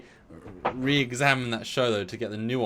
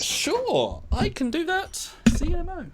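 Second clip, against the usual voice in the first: falsetto. Acted. High mock-sweet sign-off.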